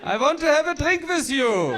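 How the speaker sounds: loud, high-pitched German order; unsexy German accent. Shown as a demonstration. German man demands a drink, loud German accent. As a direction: bark the come-on in a German accent. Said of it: "German, on the other hand".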